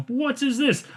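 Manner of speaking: high-pitched confused question. Retold or imitated. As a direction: squeak it out like a baffled rock star. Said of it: "they're like".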